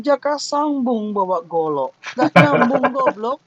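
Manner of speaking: mock scolding, slow setup then loud high punch; scornful examiner. Examiner ridiculing a student's unrelated joke, mock scolding. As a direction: chant the jibe, then punch the insult. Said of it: "dosen bilang".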